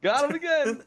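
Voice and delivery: very high, loud playful gloating; mock triumph. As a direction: crow it in a squeaky, hammed-up voice. Acting out gloating game play.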